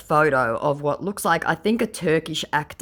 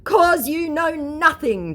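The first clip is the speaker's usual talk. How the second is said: higher, slow, pompous scammer voice; mock scammer; 11 semitones higher, 5 dB louder, slower than usual. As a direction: lay it on like a self-important conman. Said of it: "declares my scammer".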